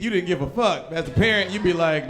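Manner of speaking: usual speaking voice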